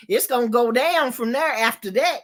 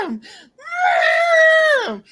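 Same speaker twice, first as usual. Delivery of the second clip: retold scream; 5 dB louder than usual. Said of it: "Kane screaming".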